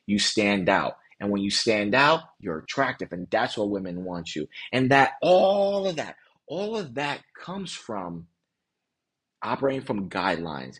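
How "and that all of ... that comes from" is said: slow, pointed stress on "all of that"; slow emphatic stress. Real, not acted. Coach driving home his main point with slow emphasis. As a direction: lean hard on "all of that", then let it fall away.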